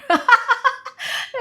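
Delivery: high, loud burst of laughter; a sudden bright laugh. Real, not acted. Laughing at her own water answer, high and loud.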